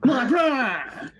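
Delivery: very high, drawn-out hammed-up cry; mock outcry. Player joining the joke about special eyes, hammed-up high cry. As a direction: echo it as a piercingly high mock wail.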